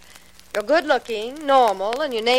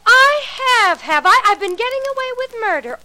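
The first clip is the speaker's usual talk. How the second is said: high, loud sarcastic echo; scornful echo; 8 semitones higher, 4 dB louder than usual. Acted. Joyce mocking Alan's accusation back at him, high and sarcastic. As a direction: throw his words back at him mockingly.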